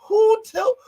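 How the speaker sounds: cracking up at the fake fight, excited high yelps; excited shout. Real, not acted. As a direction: yelp it out through laughter.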